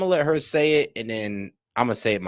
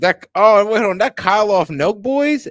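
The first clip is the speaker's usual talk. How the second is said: surprised; 8 semitones higher, 7 dB louder than usual. Real, not acted. Recognizes the prankster.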